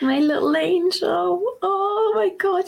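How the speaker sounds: slow, high, choked-up adoration; tearing up. Real, not acted. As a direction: gush over the cat as tears come. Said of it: "I'm literally tearing up".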